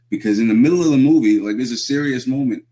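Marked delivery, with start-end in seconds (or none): none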